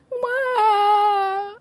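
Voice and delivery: put-on piercing high toddler sob; child's wail. A narrator's reading. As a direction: let out a high howling cry like a small child. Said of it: "while Bertie cried".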